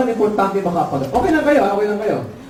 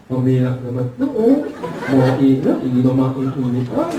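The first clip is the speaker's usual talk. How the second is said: low, muffled ngongo voice; nasal-speech customer; 9 semitones lower than usual. Acted. Ngongo customer insisting on the order, low muffled nasal speech. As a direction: garble it in a low voice. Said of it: "ngongo din".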